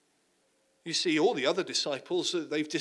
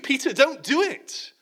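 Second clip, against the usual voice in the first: higher, louder plea like shouting at the TV; yelling at Peter; 9 semitones higher, 7 dB louder than usual. Retold or imitated. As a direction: yell a warning he can't hear. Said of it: "you almost want to yell at him".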